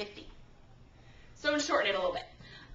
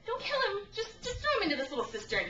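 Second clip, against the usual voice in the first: pleading brother; 10 semitones higher than usual. Retold or imitated. Reuben stepping in for Joseph.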